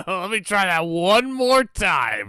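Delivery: slow, loud words pushed out through real laughter; cracking up while echoing the line; laughing repeat. Real, not acted.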